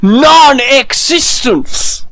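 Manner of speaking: hammed-up villain voice. Playful villain reply in a voice.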